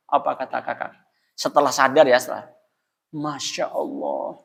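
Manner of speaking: ordinary talk, nothing marked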